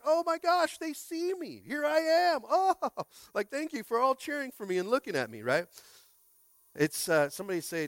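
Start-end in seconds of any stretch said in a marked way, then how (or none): 0.1-5.4 much higher, giddy and thrilled; delighted fan. Retold or imitated. Pastor voicing a fan thrilled to be seen, high and giddy. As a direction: soak up the spotlight with joy. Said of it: "the other one is like".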